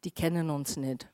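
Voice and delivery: low, soft brush-off; dismissive thought. Acted. People brush off someone who spoke, dismissive. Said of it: "und wir denken".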